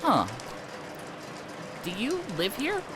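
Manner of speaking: usual speaking voice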